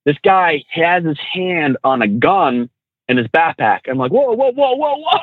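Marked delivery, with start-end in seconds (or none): 4.1-5.2 high, rapid-fire string of retold whoas; alarmed. Trainer reacting to a man's hand on a gun, alarmed. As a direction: back off in mock alarm, pitch climbing.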